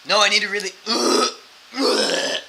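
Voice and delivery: loud protest breaking into mock retching; imitated dry heaving. Drunk man insisting he get in, imitated dry heave. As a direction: start insisting, then gag as if about to vomit. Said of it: "He's, like, dry heaving".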